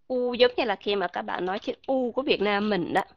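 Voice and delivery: ordinary delivery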